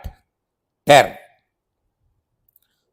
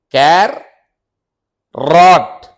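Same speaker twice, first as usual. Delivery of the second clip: ordinary talk, nothing marked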